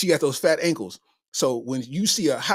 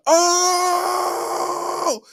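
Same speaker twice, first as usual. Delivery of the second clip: long, high crowd holler; crowd's roaring "oh"; 13 semitones higher than usual. Retold or imitated. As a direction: imitate a whole room erupting in one drawn-out "oh". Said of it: "the whole place said".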